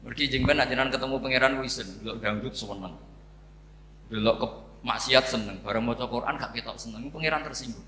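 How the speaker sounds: normal talking voice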